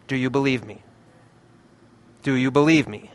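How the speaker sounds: quietly ask, with a long pause between; retold whisper. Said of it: "he whispers in my ear".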